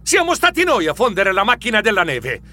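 a hero calling out the villains, proudly; proud shout. Acted. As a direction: declare victory proudly. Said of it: "gridai fieramente".

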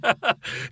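short high chuckling burst; burst of laughter. Acted. Scrooge seeing himself in the mirror, bursting out laughing. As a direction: break into happy ha-has. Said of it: "scoppiò a ridere".

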